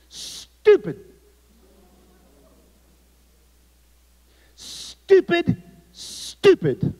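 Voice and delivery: high mock yell of stupid; shrill teacher yelling at the boy, mocking; retold yelling. Scene: algebra teacher yelling at the boy in class, high mock yell. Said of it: "yelling stupid".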